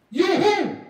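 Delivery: loud, high-pitched questioning; incredulous challenge. Acted. Jesus to the unrecognised knocker, incredulous. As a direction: throw it back at an unknown caller.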